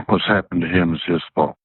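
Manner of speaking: normal talking voice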